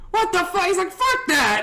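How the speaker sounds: high-pitched mock outrage; imitated indignation. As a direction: protest like a fed-up drunk buddy. Angry friend told the price.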